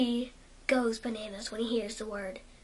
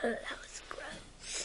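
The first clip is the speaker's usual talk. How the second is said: whisper; 8 dB quieter, much slower than usual. Real, not acted. Whisper after funny sound.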